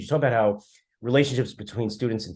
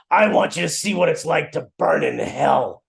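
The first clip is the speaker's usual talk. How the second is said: put-on raspy old rabbi voice; 5 semitones higher, 5 dB louder than usual. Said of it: "in this, like, raspy voice".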